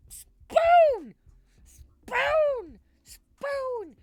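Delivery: very high, very loud shriek; meme shriek. Pretend. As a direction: scream it at the top. Screams the meme word.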